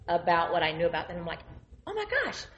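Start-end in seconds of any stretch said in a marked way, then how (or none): 1.9-2.5 high-pitched, dismayed realization; retold dismay. Woman retelling the Lord's reminder after her prison visit, dismayed realization. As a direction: act out suddenly catching yourself, voice shooting up.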